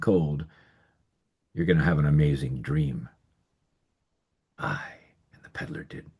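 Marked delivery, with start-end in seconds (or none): 4.6-6.1 hushed whisper; a secret whispered to listeners. A narrator's reading. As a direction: breathe it out, unvoiced. Storyteller drops to whisper.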